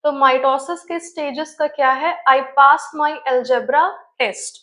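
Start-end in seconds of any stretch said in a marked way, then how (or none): 2.3-4.6 each word drawn out very slowly; slow mnemonic. Shown as a demonstration. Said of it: "ये आपका trick है". (धीरे-धीरे, हर शब्द अलग)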